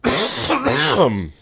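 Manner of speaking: real cough; hoarse coughing fit. Real, not acted. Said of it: "Excuse me, I have to cough".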